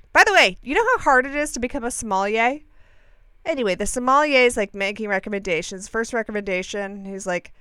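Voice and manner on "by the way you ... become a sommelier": ordinary delivery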